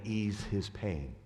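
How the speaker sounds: lightly voiced mystery voice, low and slow; a hint of an otherworldly messenger; mysterious voice. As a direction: draw it out low, like a cryptic message. Quoting the mysterious voice.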